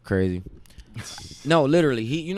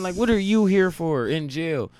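ordinary delivery

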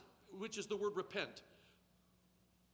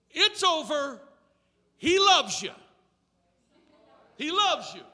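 louder, higher joyful preaching with laughs; exuberant preaching; 9 semitones higher, 13 dB louder than usual. Acted. Preacher telling the church it's over, louder and higher with joy. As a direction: celebrate it to the crowd.

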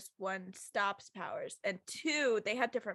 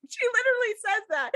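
laughing disbelief; 10 semitones higher, 8 dB louder, faster than usual. Real, not acted.

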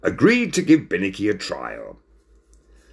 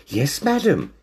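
higher-pitched, courteous reply; very polite; 7 semitones higher than usual. A narrator's reading. Waiter answers a lady customer very politely. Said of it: "he replied very politely".

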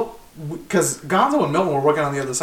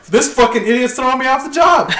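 higher-pitched indignant complaint; retold indignation; 7 semitones higher than usual. Fired worker telling a coworker, high indignation.

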